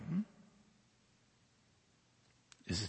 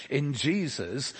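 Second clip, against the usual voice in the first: ordinary talk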